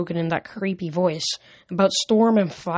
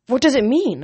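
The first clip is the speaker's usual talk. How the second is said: higher and louder, insisting on an answer; demanding; 6 semitones higher, 6 dB louder than usual. A narrator's reading. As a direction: push the question hard, demanding. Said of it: "I demanded".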